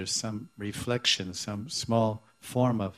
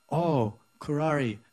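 higher-pitched, lightly acted lament; mock lamentation; 5 semitones higher, 3 dB louder than usual. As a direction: call to the bird with a touch of pining.

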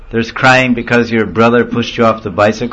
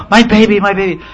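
high, urgent mock panic; acted maternal alarm; frantic mother; 10 semitones higher than usual. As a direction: cry out as a mother rushing to her child. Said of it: "And she's frantic".

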